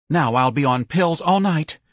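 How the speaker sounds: high-voiced dramatic complaint; fretting young man. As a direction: fret theatrically about your nerves.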